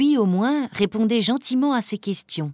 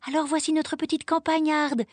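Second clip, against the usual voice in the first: higher, delighted grandmother's greeting; exclaiming; 7 semitones higher than usual. A narrator's reading. Grandmother greeting Heidi, high delighted exclamation. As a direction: greet the girl in a high, pleased voice. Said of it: "s'exclama-t-elle".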